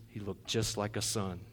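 ordinary delivery

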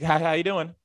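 high-pitched, over-eager canned hello; NPC coworker greeting. Retold or imitated. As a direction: imitate the coworker's game-character greeting. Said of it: "That's her".

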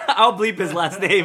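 real laughter bursting into the apology; laughing. Real, not acted. Podcaster who slipped out a friend's last name, laughing loudly. Laughs after naming someone by mistake.

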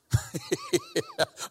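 high squeaky giggle; a real burst of laughter at himself; laughing at his own joke. Real, not acted.

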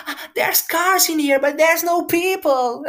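high, slow whiny mock crying; imitating crying girl. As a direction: wail it like a lost crying girl. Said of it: "A chorar, bué". (imitação lenta e aguda de miúda a chorar)

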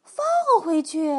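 loud, stretched disbelief; startled storybook animals asking as one; astonished question. A narrator's reading. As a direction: blurt it out incredulously. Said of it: "他们齐声问道".

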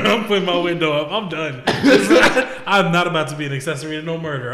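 amused mock refusal, laughing. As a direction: play the fleeing witness while cracking up. Laughing through acted getaway.

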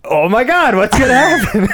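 mock suspense. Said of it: "making this face, like".

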